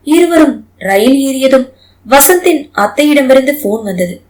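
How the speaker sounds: ordinary delivery, nothing marked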